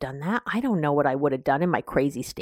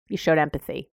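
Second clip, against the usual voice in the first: ordinary delivery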